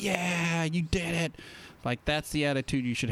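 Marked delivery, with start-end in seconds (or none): 0.0-1.3 high, drawn-out mock cheer; imitated cheering. Acting out parents applauding a toddler's first steps, high drawn-out cheer. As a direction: cheer like a proud parent, pitched up. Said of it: "everyone's like".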